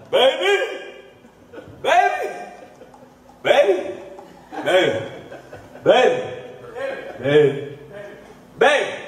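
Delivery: hammed-up drunk yelling after her; drunk calling out.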